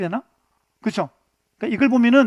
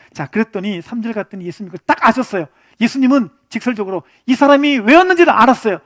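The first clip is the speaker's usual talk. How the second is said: normal talking voice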